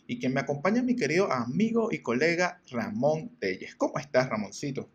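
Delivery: mock sultry podcast intro; lightly hammed-up host voice; sultry narrator. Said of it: "Voy a poner tu voz sensual".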